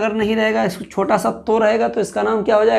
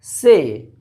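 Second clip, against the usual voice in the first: reciting letter names; much slower than usual. Shown as a demonstration. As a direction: call out each letter for the class to repeat. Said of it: "ये क्या है?".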